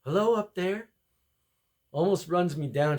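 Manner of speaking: ordinary talk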